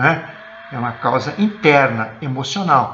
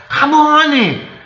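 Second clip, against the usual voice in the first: high, loud, sudden cry of joy; joyful cry of recognition; 12 semitones higher, 5 dB louder than usual. Retold or imitated. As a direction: burst out as she finally knows him. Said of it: "lhe disse em hebraico".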